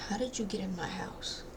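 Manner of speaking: hushed scared whisper. Real, not acted. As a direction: breathe it out in fear. Hushed reaction.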